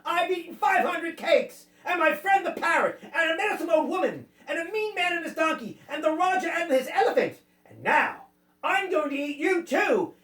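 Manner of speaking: high, theatrical cat voice; boastful cat. A narrator's reading. Cat threatening to eat the land crabs, boastful. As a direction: rattle off the boast, ending in a threat.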